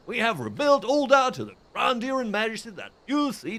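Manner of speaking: high-pitched, hammed-up American accent; bad American accent. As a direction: play an old station master in a badly faked American accent. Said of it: "that is my bad American accent".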